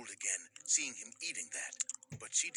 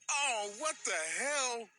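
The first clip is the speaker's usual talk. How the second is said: outraged shock; 10 semitones higher, 3 dB louder, slower than usual. Retold or imitated. Spat on, outraged.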